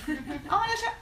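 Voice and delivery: mock the "how random" shrug; mock dismissive. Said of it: "we say that all the time".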